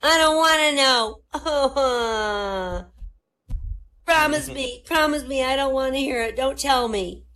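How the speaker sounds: put-on high, loud begging child; high frantic kid pleading not to hear it. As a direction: whine it like a panicked ten-year-old. Ten-year-old son begging.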